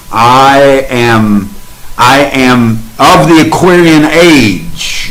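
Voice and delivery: each "I am" line said slowly and deliberately, falling at the end; slow affirmations to the group as the way to talk about yourself. Shown as a demonstration. Slow, deliberate affirmations. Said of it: "Whatever you say after "I am," that's what you become".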